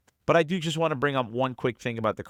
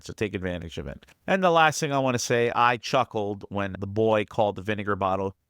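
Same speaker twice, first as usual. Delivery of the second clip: ordinary talk, nothing marked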